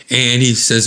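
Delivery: ordinary delivery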